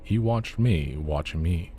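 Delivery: plain reading voice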